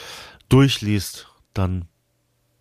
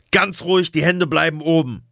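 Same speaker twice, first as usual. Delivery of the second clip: shouting; 7 semitones higher than usual. Acted. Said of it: "schrie einer der Männer".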